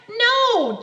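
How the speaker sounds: loud exasperated shout. Acted. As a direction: yell it, fed up. Shouted refusal.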